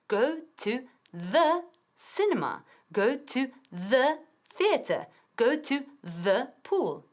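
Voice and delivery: slow, clear drill phrases; slow model reading. Shown as a demonstration. Teacher drills phrases for students, slowly. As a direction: read each phrase slowly for learners to copy. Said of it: "teacher models phrases slowly".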